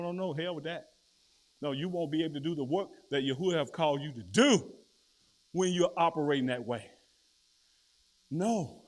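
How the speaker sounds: normal talking voice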